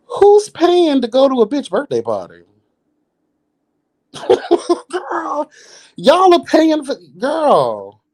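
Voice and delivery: cackling disbelief at the bill; shrieking with laughter at the idea; incredulous outburst. Real, not acted. Outraged over birthday party.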